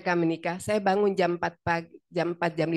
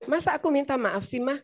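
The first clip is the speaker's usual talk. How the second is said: higher, whining "Masa aku minta maaf sih"; whiny protest; 7 semitones higher than usual. Retold or imitated. Daughter protesting to her mother about apologising, whiny. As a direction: sulk like a grown child told to apologise. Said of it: "kata si Ike".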